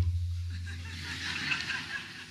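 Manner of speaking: breathy unvoiced chuckle; soft real laugh. Real, not acted. Joking to the audience, a soft breathy chuckle. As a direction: laugh lightly at his own joke.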